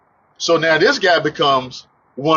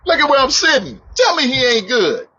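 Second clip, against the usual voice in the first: high-pitched, thankful outburst; exultant testimony; 10 semitones higher than usual. Real, not acted. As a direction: testify with rising joy, voice lifted high. Preacher bursts into grateful testimony.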